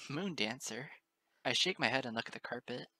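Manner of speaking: usual speaking voice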